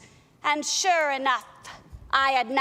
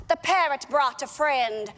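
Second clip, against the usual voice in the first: ordinary talk, nothing marked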